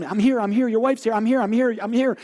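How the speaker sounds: imitating the wife calling her husband awake. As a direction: call out insistently to wake him.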